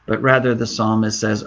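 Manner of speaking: ordinary delivery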